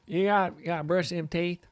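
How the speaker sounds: put-on high-pitched Tennessee country accent. Trader joking about brushing teeth, in a Tennessee accent. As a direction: play it up as a high Tennessee local.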